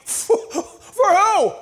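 high, slow, laughing scoff; laughing ridicule. Acted. The enemy laughs at the praying believer, slow high ridicule. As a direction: laugh at the very idea.

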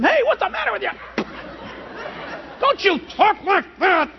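high, hammed-up telling-off; mock scolding. Acting out scolding an angry person.

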